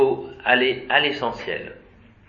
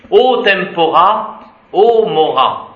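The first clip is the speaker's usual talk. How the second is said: slow, loud, higher oratorical cry; declaimed exclamation; 5 semitones higher, 6 dB louder, slower than usual. Acted. Teacher declaiming the Latin quote to students, slow and grand. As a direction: proclaim it like a Roman orator.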